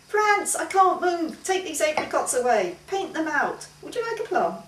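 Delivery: begging to be freed; distressed young woman. Acted. Maggie trapped in portrait.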